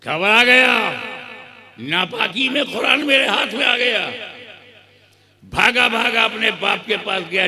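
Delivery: high, loud pulpit voice building the story; fiery preaching. Acted. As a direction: preach it to the crowd at full force. Preacher's story at full force.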